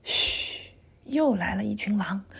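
soft, secretive shush; quiet child's secret; hushed warning. A narrator's reading. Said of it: "我小声说".

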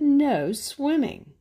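slow, loud, firm No swimming; emphatic slow sign read; stern sign. Acted. Reading the king's sign.

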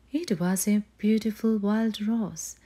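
plain reading voice

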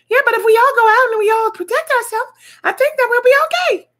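high pleading cartoon voice; high put-on voice. Acted couple arguing about going out.

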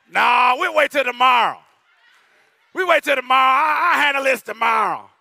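hammed-up stern father deferring punishment; parent shouting. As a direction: boom it out like a stern dad putting it off. Parent voiced postponing whooping.

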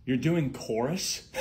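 ordinary talk

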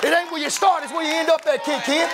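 loud, high, whooping sermon climax; preaching at full force. Acted. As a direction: rise into a shouted, sing-song preacher's whoop over the organ.

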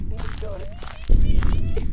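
tiny, very high squeal of fear; frightened whimper. Real, not acted. Player sure Slender Man is gonna eat her, frightened squeal.

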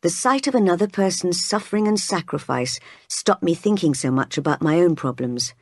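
usual speaking voice

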